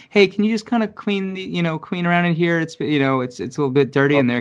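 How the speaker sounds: high, coaxing fake-nice request to the cleaning lady; mock sweet boss. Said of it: "be like".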